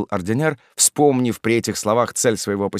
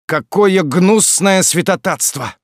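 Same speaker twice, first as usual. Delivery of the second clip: high, loud, drawn-out indignation; outraged cry; 9 semitones higher, 6 dB louder, slower than usual. A narrator's reading. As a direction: denounce it slowly, voice raised. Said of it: "вскричал ординер". (возмущённый возглас)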